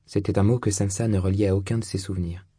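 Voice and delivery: ordinary delivery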